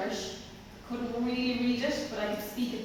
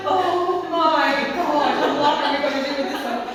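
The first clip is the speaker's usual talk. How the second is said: mock despairing headmaster; 6 semitones higher, 12 dB louder than usual. Retelling the headmaster giving up on her, loud mock despair. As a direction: drag out oh my God, then rush the rest with a laugh.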